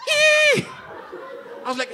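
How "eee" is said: shrill comic scream of panic; high shriek. Acted. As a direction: squeal in mock terror. Reacting to "can you run.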